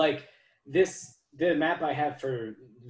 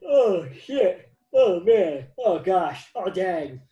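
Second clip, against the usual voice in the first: loud startled exclamations; shocked outburst, loud; 5 semitones higher, 10 dB louder, much slower than usual. Real, not acted. Startled reaction.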